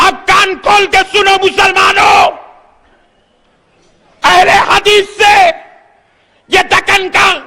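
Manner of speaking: high, slow, shouted proclamation; full-force preaching challenge. Acted. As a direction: throw down a challenge to the rival sect. (بلند آواز میں خطیبانہ challenge)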